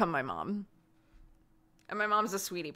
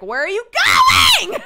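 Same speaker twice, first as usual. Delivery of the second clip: louder, higher call; 14 dB louder, faster than usual. Retold or imitated. Partner calling after her as she leaves, louder. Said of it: "she'll be like".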